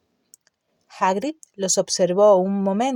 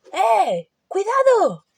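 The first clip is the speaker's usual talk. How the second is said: warning shout; 12 semitones higher, slower than usual. A narrator's reading. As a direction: yell it out to stop them. Hagrid warning from afar.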